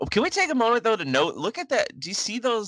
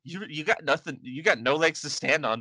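ordinary talk

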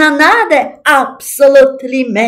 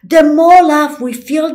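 usual speaking voice

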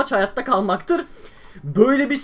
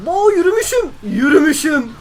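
high surprised whine; 9 semitones higher, 6 dB louder than usual. Real, not acted. Surprised he walked.

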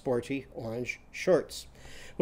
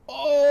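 loud high Oh! as if pulling hard on oars; big groan; 11 semitones higher, 7 dB louder than usual. Shown as a demonstration. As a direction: heave and groan Oh!. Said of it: "give a great big groan and go".

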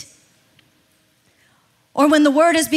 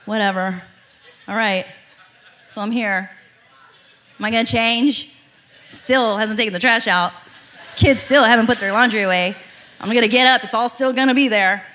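a sulky wife dragging herself into prayer; grudging sulk; 8 semitones lower than usual. Retold or imitated. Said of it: "I still walk in stomping my feet".

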